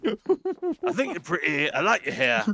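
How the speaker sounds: mock flattery to the character; silly flattering voice.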